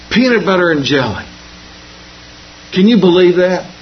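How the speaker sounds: lightly voiced kid, mild disappointment; a hint of a kid sighing at his lunch; grumbling schoolkid. Kid opens lunch, complains.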